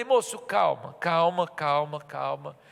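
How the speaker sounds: calm him down gently; soothing. Retold or imitated. Pastor calming the upset stranger, soothing and fading.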